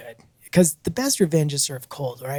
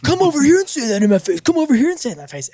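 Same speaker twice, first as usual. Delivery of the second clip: loud, high, rapid mock challenge; hammed-up angry dare, rushed and repeated; Karen-style blow-up; 8 semitones higher, 6 dB louder, faster than usual. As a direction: imitate an irate neighbor picking a fight. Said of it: "Karen kinda thing".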